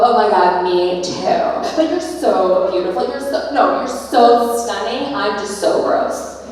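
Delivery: loud, over-the-top gushing between friends; mock commiserating gush. Girlfriends bonding over shared body complaints, loud mock gushing. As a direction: gush loudly, then put yourself down. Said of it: "we would feel like we belonged together".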